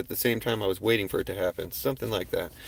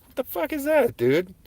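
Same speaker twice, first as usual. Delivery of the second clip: high, startled what the fuck; blurting it out, taken aback; shocked disbelief; 13 semitones higher than usual. Retold or imitated. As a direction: voice jumps up in alarm. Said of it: "I'm like".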